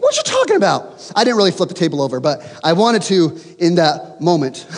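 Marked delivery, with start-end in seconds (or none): 0.0-1.0 high squeaky indignation; hammed-up disbelief; very high, playful protest; mock outrage.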